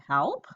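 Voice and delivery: HELP rising sharply at the end; "Help?" with a questioning upturn; question tune. Shown as a demonstration. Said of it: "If I were a question".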